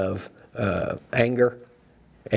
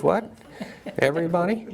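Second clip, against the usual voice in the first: mock surprise; 6 semitones higher, faster than usual. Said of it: "And she goes".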